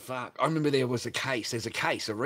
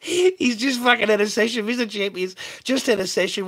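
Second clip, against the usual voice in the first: much higher and louder, near shrieking; excited loud rant; 10 semitones higher, 10 dB louder than usual. Real, not acted. Ranting to viewers about the customer, high and loud. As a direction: crank it up high and loud, laughing through it.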